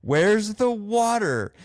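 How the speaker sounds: high, slow, incredulous cry of where's the water; high mock outrage. Host mocking the governor's empty hydrants, high and slow. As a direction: drag it out high in mock disbelief.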